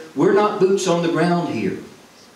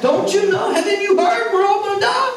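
panicked shriek. Retold or imitated. Preacher mimics a panicked shopper with a high frantic shriek. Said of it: "she was just".

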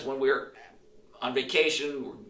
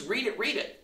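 put-on kid calling out; 7 semitones higher than usual. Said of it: "some kid just randomly".

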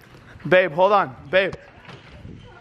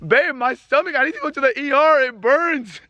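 high, urgent plea in pain; pained pleading; 6 semitones higher than usual. Real, not acted. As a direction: beg for help with a burning stomach. Pleading with burned stomach.

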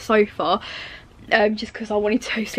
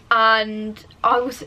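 ordinary talk

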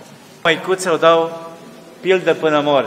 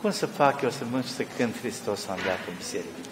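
put-on softer, a hushed weeping old nun; tearful quiet plea; 5 semitones lower, 8 dB quieter than usual. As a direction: let the words fall softly, near tears. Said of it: "așa plângea".

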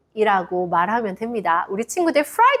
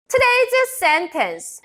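announcing; 10 semitones higher, much slower than usual. Shown as a demonstration. Teacher announcing the sentence.

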